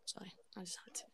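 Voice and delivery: whispered sheepish apology; whispering. Real, not acted. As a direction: whisper it guiltily. Whispered apology after joke.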